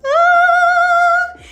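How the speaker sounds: high, loud squeal of delight; excited squeal. Real, not acted. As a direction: shriek with joy at the reveal. Unboxing the PR kit.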